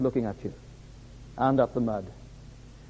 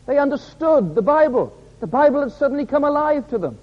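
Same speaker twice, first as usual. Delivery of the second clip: high, exultant pulpit voice, building; fervent preacher ringing out the good news; impassioned preaching; 6 semitones higher, 3 dB louder than usual. Acted. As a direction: climb steadily to a joyful climax.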